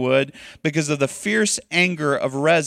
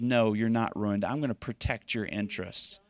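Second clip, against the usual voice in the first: softer, sinking to a hush at the promise; soothe, don't preach; gentle voice of God comforting; reassuring God voice; 5 semitones lower, 8 dB quieter than usual. Acted. God answering a ruined marriage.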